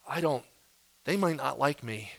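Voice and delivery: soft, halting excuses with pauses; awkward, timid person. Acted. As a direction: shrink back shyly, trailing each phrase. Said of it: "The person who's like".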